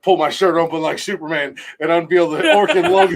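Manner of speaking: ordinary talk, nothing marked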